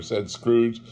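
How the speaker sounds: usual speaking voice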